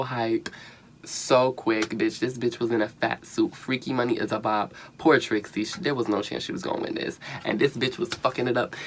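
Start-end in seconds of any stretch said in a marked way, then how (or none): none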